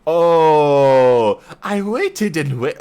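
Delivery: half-hearted Irish-Scottish brogue attempt; attempted Irish accent. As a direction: try the fey accent and lose it halfway. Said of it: "This is the accent I can't do".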